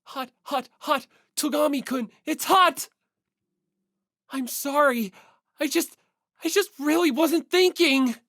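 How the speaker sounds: yelping from the hot iron, then flustered apology; high frantic apologetic voice; burned yelp. Acted. Naegi burned while ironing.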